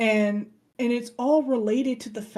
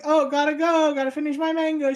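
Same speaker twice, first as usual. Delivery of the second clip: higher, lightly voiced brush-off; dismissive slacker; 6 semitones higher, 5 dB louder, faster than usual. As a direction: hurry him off with a light mock excuse. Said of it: "he's like".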